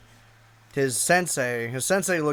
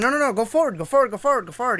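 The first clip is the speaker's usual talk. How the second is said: higher, hurried repeats; urgent directions; 7 semitones higher, faster than usual. Retold or imitated. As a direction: stop him from turning, quick. Urgently correcting driver's wrong turn.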